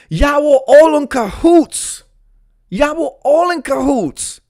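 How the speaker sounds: high, loud, drawn-out indignation; hammed-up high-pitched accusing wail; outraged accusation. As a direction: holler it like exposing a conspiracy. Accuses the seamstresses of conspiracy.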